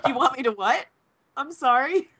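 high, baffled disbelief; incredulous. Retold or imitated. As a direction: ask it in disbelief. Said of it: "And I'm like".

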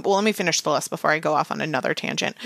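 rattled off like an auctioneer; fast talking. Real, not acted. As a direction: rush through it, fast. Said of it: "while I'm talking like an auctioneer".

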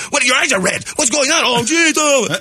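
high-pitched mock intervention; acting out a fretting family; alarmed relatives. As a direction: fire off worried questions.

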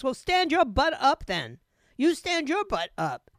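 hammed-up schoolyard taunt; mock shout. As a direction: bark the dare, mock tough. Taunting starts to escalate.

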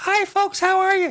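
squeaky, very high-pitched voice; helium voice. Pretend. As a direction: sound like you inhaled helium. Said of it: "I probably sound like I'm on helium".

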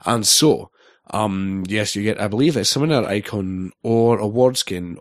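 usual speaking voice

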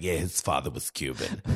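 deep, quiet New York mother; smoker's New York voice. Retold or imitated. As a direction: imitate a chain-smoking New Yorker, very low. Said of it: "She sound like she's been smoking a couple Cubans".

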